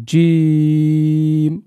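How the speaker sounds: জিম stretched slowly; drawn-out letter. Shown as a demonstration. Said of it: "একটু বেশি টান দিয়ে বলবো আমরা". (জিম বেশি টেনে)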